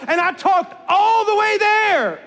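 ordinary talk, nothing marked

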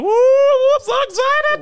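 high, loud, slow mock squeal of joy; mock excited squeal. Imitating his lady squealing about Valentine's plans, high and loud. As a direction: ham up her giddy excitement. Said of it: "That motherfucker was like".

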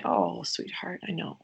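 put-on soft, whispered comfort to a hurt child; hushed, tender sweetheart. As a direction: soothe a crying little one in a hush. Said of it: "We, we say".

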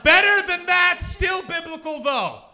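hammed-up high, full-volume yell; huge mock-triumphant shout; excited shout. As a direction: bellow the pitch like an overexcited executive. Acted excited Hollywood pitch.

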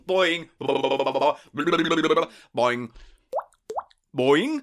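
a springy "boing" popped with the lips; mouth boing noise. Acted. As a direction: make a cartoon spring sound with your mouth, three times. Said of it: "That noise you made with your mouth".

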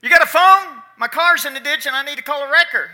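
shouted high and loud, hurried at the end; yelling across a field. Retold or imitated. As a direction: call out to a far-off farmer. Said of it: "he yelled out to the guy".

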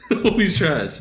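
laughing through the words. Real, not acted. Repeats the line, laughing the second time.

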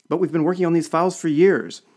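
high, loud protest; indignant exclamation. A narrator's reading. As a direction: exclaim it as an aggrieved objection. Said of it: "exclaimed another trade commissioner".

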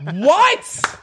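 a mock-outraged refusal; outraged disbelief. Retelling his outrage at his fiancee's BLT order.

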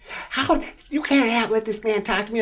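very high, sputtering outrage; mock indignant. Bank woman protesting to the manager, very high and sputtering. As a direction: ham up her disbelief.

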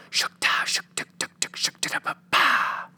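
whispered beatbox transition sound; Doug transition noise. Retold or imitated. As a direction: whisper the cartoon scene-change noise rhythmically. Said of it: "would sound like".

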